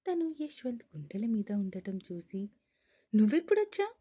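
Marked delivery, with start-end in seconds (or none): 3.1-3.9 startled yell, pitched high and loud; wife waking on her husband, yelling in surprise; loud shout. A narrator's reading. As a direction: shout it out in sudden shock. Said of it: "గట్టిగా అరిచింది".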